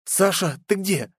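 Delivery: high, urgent mild yell; crying out. A narrator's reading. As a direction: call out in alarm without full shouting. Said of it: "снова закричала Катя".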